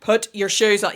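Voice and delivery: loud, exasperated order; exasperated shout. Shown as a demonstration. Parent losing patience with a child, shouting. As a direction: bark the order at the kid. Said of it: "you have to change that".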